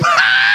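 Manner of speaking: very high, shrieking laugh; belted-out laugh. Shown as a demonstration. Man showing his uncontrollable laugh, belted out high. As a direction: let out a sudden high cackle. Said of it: "Sometimes I'll belt it out".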